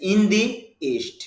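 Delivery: "the" said dee before the vowel of east. Shown as a demonstration. Teacher models the before a vowel, "the" pronounced dee. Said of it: "টি এইচ সি এর উচ্চারণ দি হবে".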